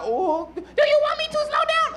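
very high put-on falsetto; woman's voice. Spanish woman asking her lover about slowing down, hammed-up falsetto. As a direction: coo the question way up in falsetto.

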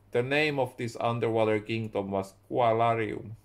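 plain reading voice

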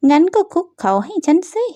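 bully telling her rival to kneel down; mocking demand. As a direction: lord it over her with a sneer.

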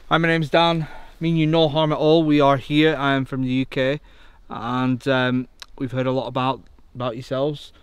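loud, formal address to the unseen; calling out to spirits. Acted. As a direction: announce yourself clearly to any ghosts present. Calls out to the spirits.

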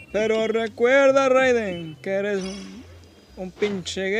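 slow, grand announcer voice; epic announcer voice. Acted. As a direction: proclaim the champion. Grand slow praise of Raiden.

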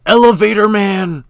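high, loud fan shout; hyped cheer. Real, not acted. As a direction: cheer the hero's nickname out loud. Hyped reaction to character.

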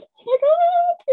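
high, loud squeal of joy at the sight of the book; excited shriek. Retold or imitated. Family spotting her book in the store, squealing with joy. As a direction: shriek it out, overjoyed, slowly.

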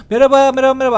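loud hailing hello; a loud greeting shouted across the street; calling out loudly. Acted. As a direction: call out to get the driver's attention. Calling out to a driver.

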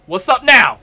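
loud, cocky challenge; triumphant taunt. Real, not acted. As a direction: crow at the opponent as you land hits. Taunting boss while winning.

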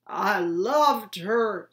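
slow, loud cry; anguished insistence. A narrator's reading. Father shouting back at his son, anguished. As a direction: drag it out in pain.

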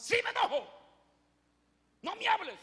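high, loud sulking yell; high furious shout. Acted. Jonás responde a Dios. (Jonás le grita a Dios, alto y furioso)

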